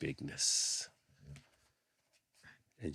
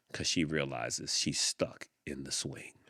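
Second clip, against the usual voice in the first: ordinary delivery, nothing marked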